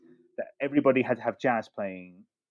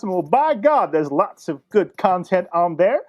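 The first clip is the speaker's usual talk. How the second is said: southern by God; 8 semitones higher, 8 dB louder, slower than usual. Pretend. Sliding back into southern bit.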